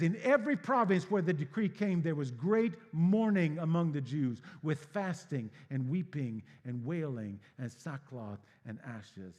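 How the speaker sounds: loud, high preaching; booming scripture reading. Real, not acted. Preacher reading scripture aloud in a booming voice. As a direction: proclaim the verse to the whole hall.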